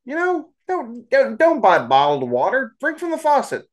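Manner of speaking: high sing-song propaganda voice; dystopian announcer. Pretend. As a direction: sell it sweetly to the masses.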